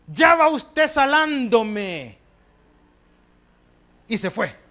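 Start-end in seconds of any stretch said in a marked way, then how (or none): 0.1-2.2 slow, louder, higher irritated jab at mother; irritated retort. Retold or imitated. Grown son, fed up, snapping at his nagging mother: slow, loud and high.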